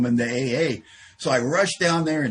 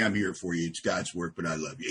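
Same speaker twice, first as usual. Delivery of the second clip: soft, lower, fake-sincere; mock tender reassurance; 8 semitones lower, 5 dB quieter than usual. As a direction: lay the piety on gently. Mock tender sponsor reply.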